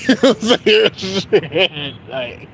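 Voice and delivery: drawn-out words breaking into giggles; slow down as real laughter takes over; laughing through words. Real, not acted. Cracking up at his own angry-stare bit, laughing.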